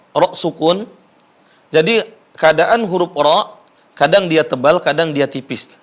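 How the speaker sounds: ordinary talk, nothing marked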